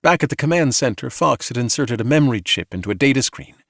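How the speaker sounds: usual speaking voice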